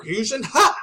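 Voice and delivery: slow build to a sudden high, loud "Ha!"; fusion dance shout. Retold or imitated. Fan acts out the cartoon fusion move, ending in a shout. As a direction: act out the cartoon fusion call with a big yell at the end. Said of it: "Like this".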